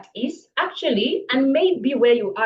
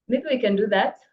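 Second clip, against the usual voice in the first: ordinary delivery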